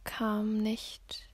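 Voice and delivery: ordinary talk, nothing marked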